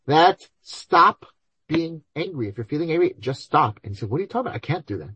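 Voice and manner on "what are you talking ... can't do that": incredulous objection. Retold or imitated. Listener objecting to the rabbi.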